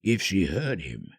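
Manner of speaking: plain reading voice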